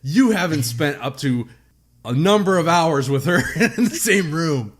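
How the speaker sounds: loud, high, hammed-up telling-off; mock indignant rant. Host claims sole right to tease co-host, mock outraged. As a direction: lay down the law in playful outrage.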